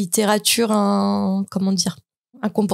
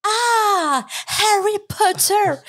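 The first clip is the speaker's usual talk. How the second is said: high, slow English "Harry Potter" with a breathy H; posh English correction; 11 semitones higher, much slower than usual. Retold or imitated. English saleswoman correcting a French customer's pronunciation, mock posh. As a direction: mimic the saleswoman correcting you, much higher. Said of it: "la vendeuse, elle fait".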